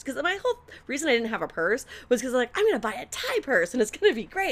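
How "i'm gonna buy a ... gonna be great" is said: high, lively quote of an eager plan; hammed-up eager optimism; mock enthusiasm. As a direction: act out naive excitement, pitched up. Quoting own naive earlier plan.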